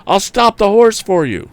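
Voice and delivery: high loud call. A narrator's reading. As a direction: call it over the rattle. Chasing runaway wagon.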